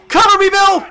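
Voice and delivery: high, loud urgent call; cover me shout. Real, not acted. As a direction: shout the order across. Calling to ally in game.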